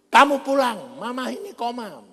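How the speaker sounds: high, loud acted summons; urgent phone plea. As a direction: cry out the bad news as a relative calling. Sister's urgent phone call acted out.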